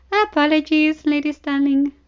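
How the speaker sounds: amused, unrepentant. Acted. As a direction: apologize without meaning a word of it. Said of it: "amused and unrepentant".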